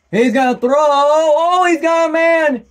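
high, loud burst as the pass flies, easing slightly; urgent live call of a big play; excited play-by-play. Real, not acted. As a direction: get swept up in the catch. Calling a long completed pass.

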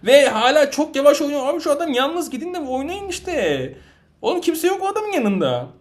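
frustrated yelling. Real, not acted. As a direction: let the rage spill over. Raging at slow teammates.

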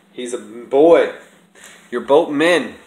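loud, slow scolding of the cat; real loud telling-off. Real, not acted. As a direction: tell the cat off loudly.

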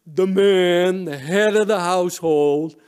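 slow, loud proclamation; hammed-up patriarchal announcement; mock man-of-the-house. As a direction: draw it out like a self-declared head of household. Daughter quotes father's claim to authority.

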